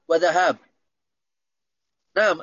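ordinary delivery

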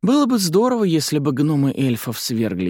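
put-on high little-girl voice, sighing wishfully; wistful kid daydreaming aloud; dreamy child. A narrator's reading. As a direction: start very high, then settle into a wish. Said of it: "мечтательно вздохнула Марца".

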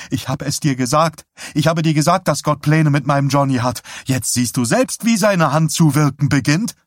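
high, loud, insistent vindication; fervent triumph. A narrator's reading. Wife tells husband God has plans, fervent triumph.

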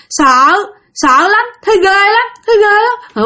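put-on slow, high, tearful child's whimper; frightened child, slow. A narrator's reading. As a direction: drag the words out like a scared toddler. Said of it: "nó nói". (giọng bé con rên rỉ chậm rãi vì sợ)

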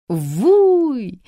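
loud excited shriek; excited cry. Acted. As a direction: burst in shouting with excitement. Aunt bursts in excited.

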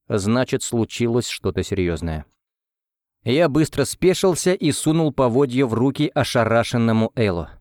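plain reading voice

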